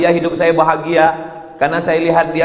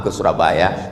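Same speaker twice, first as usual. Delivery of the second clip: ordinary talk, nothing marked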